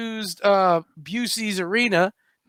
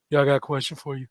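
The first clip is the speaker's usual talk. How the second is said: low, soft, casual Shaq impersonation; quoting laid-back Shaq; 5 semitones lower, 6 dB quieter than usual. Retold or imitated. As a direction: lightly voice a chilled-out big star, low and quiet. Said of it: "he just literally goes".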